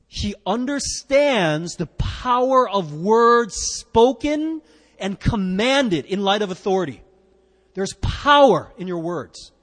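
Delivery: slow, loud proclaiming; preaching at full force. Acted. Preacher declaring the power of words, slow and loud. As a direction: drive each line home from the pulpit.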